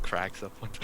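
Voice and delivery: ordinary talk, nothing marked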